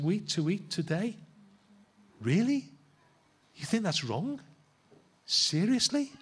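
high, mock-incredulous questions; repeated rising "Really?" in amused disbelief; incredulous Jesus. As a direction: play it as gentle disbelief, not anger. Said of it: "He's going".

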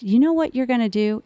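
usual speaking voice

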